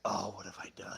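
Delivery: whispered, regretful aside to oneself; hushed mock dismay; whispered dread. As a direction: whisper it like you've walked into a trap. Silent dread at being cornered.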